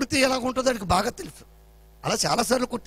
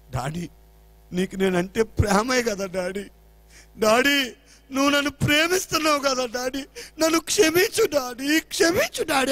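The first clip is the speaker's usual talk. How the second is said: imitated tearful plea; 5 semitones higher, 5 dB louder than usual. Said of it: "అని ఏడుస్తున్నాడు".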